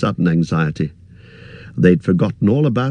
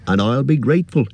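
higher, fussy innkeeper voice; chatty innkeeper chattering on, pitched up; 6 semitones higher than usual. Acted. Innkeeper warning hobbit guest, higher fussy voice.

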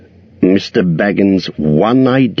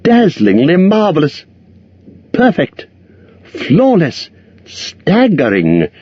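high, gushing hobbit praise; exclaiming in awe; 10 semitones higher than usual. A narrator's reading. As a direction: pile on the flattery. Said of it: "exclaimed Bilbo aloud".

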